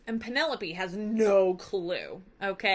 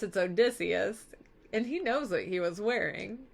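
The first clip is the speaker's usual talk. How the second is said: ordinary talk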